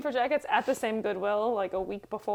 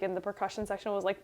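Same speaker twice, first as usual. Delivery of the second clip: ordinary talk, nothing marked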